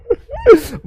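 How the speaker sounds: high-pitched burst of laughter. Real, not acted. Teacher laughs after pranking the students, high burst. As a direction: laugh at your own joke.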